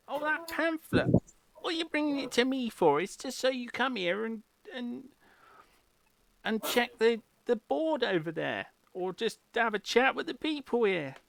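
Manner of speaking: high Cockney landlady; Cockney barkeep scolding the bard. Acted. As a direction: brush him off in Cockney. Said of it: "trying to do a Cockney accent".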